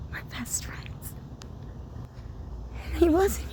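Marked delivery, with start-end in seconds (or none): 0.1-1.2 whispered slowly, barely voiced; soft whisper. Real, not acted. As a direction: breathe it out as a tender secret. Repeats phrase, now whispered.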